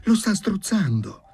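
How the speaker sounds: high, loud alarmed plea; urgent high-pitched cry; imploring. A narrator's reading. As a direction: protest in alarm. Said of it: "implorarono contemporaneamente le due donne".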